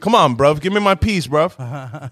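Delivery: a hint of a London street accent, bruv; light London road-man voice, mostly his own; London drug dealer accent. Friends joke about Top Boy, so a lightly voiced London dealer accent. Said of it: "talking like a London drug dealer".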